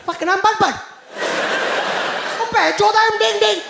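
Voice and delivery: high-pitched absurd mock greeting; hammed-up parody of two men hailing each other; Indian men greeting. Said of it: "one Indian person walk up to another".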